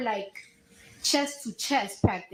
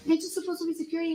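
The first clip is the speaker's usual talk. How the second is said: higher, rushed, indignant question; retelling an exasperated confrontation, pitched up; retold indignant challenge; 6 semitones higher, much faster than usual. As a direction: challenge the guard in a fast, higher voice.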